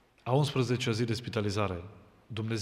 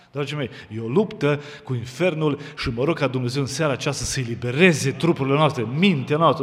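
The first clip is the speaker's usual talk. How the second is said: fiery preaching; 6 dB louder than usual. Acted. Preacher lecturing the congregation about imagined illness, full force. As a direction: preach it out to the crowd.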